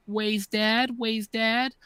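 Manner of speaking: a hint of a small boy calling his dad; lightly voiced child saying "Ways, Dad"; imitating a little kid. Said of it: "he always says".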